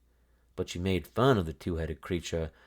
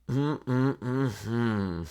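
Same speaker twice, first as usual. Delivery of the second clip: disapproving hum; 4 semitones higher than usual. A narrator's reading. Old woman disapproving hum.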